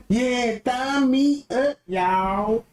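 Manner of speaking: slow, drawn-out mock YouTuber voice; mocking the other YouTuber. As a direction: stretch it out like a lazy vlogger. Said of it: "You can listen to how he".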